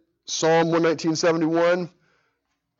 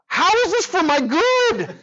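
high, slow, whiny cry of disbelief; a drawn-out, high, despairing question; exasperated plea; 5 dB louder than usual. Retold or imitated. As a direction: plead to the ceiling like a worn-out dad at 3 a.m. Exhausted father up all night.

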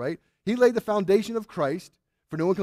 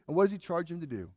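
normal talking voice

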